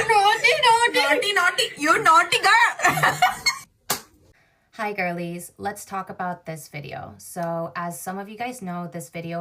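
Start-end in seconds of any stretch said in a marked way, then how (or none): 0.1-3.2 high, loud, laughing through the words; can't stop laughing; laughing while talking. Real, not acted. Laughing through repeated accent takes.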